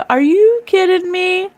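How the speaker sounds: can't believe it; disbelief. Real, not acted. Vlogger reacts to a tarot card in disbelief.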